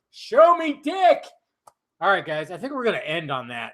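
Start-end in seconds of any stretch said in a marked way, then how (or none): 0.1-1.2 high drawn-out repeat. Acted. Vlogger repeating his catchphrase, high and slow. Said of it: "catchphrase repeated".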